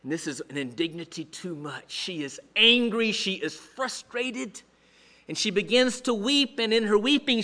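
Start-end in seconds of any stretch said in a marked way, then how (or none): none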